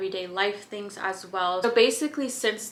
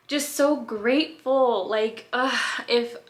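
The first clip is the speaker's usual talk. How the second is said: higher, louder, slow and gushing; overwhelmed gratitude; 3 semitones higher, 5 dB louder, slower than usual. Real, not acted. Host telling listeners how grateful she feels, gushing. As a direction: let the gratitude spill over, drawn out. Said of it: "which makes me so happy".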